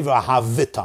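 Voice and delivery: v'ahavita with an extra syllable after V; moving shva in v'ahavita. Shown as a demonstration. Teacher showing what not to say, voiced shva in v'ahavita. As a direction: sound the shva as a vowel in v'ahavita. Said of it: "I'm not supposed to say".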